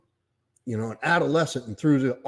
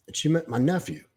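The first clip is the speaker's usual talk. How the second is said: ordinary delivery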